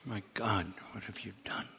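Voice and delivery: hushed, breathy accusing whisper; a horrified whisper. Acted. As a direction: breathe the question out.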